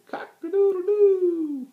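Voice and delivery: very high, loud, slow crow; man imitating a rooster; trying a rooster crow. Shown as a demonstration. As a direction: crow like a rooster, giving it a try. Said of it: "listen to me try it".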